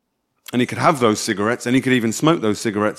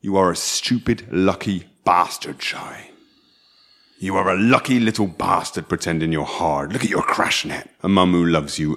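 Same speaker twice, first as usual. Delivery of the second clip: stern lecturing carer; 5 semitones lower than usual. Acted. Telling Shai he's lucky not to be in prison, low stern lecture. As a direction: lecture a troubled lad, low and measured.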